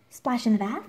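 plain reading voice

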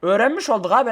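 ordinary talk, nothing marked

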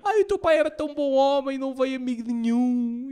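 mother crying at the wake, hammed-up high wail; mock crying mum. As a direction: whine like a weeping mother. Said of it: "a minha mãe a chorar".

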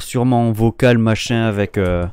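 normal talking voice

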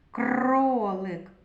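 Кролик with a long rolled р; drawn-out Р. Shown as a demonstration. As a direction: roll and hold the р in Кролик. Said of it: "Другий звук Р".

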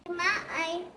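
ordinary delivery

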